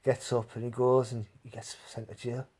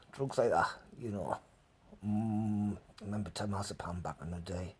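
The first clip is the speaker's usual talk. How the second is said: ordinary delivery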